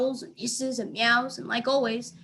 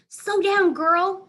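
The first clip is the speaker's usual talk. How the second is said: high, loud command; calling out; 9 semitones higher, 6 dB louder, much slower than usual. A narrator's reading. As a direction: rein the dog in. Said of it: "she nails".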